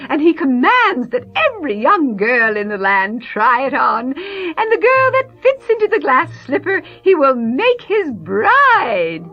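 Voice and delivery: wicked stepmother. Acted. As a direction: gush like a scheming stepmother. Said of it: "the mother saying to her".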